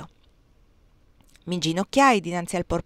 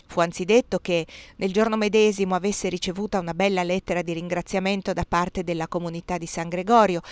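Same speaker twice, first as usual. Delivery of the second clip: plain reading voice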